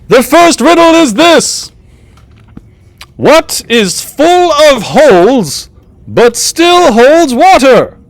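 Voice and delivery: slow, high-pitched jester riddle-master; theatrical high jester, words drawn out; jester posing riddle. Acted. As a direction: pose the riddle slowly in a piping voice.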